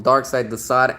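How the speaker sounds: ordinary delivery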